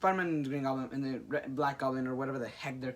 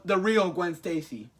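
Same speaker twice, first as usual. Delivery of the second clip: normal talking voice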